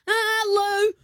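put-on high squealing child voice; high excited kid cry; kid's squeal. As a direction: squeal it like a five-year-old. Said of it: "he's going".

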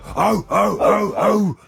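high, rhythmic barking; imitated rapper's bark. Host imitating a rapper walking out on stage, barking. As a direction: bark out a hype chant. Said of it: "walk out and just go".

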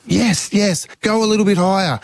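high, eager cheering, re-enacted; proud dad. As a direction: urge the kid upward like a thrilled dad. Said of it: "I was a proud dad".

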